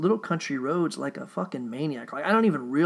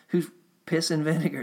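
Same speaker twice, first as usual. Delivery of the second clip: ordinary talk, nothing marked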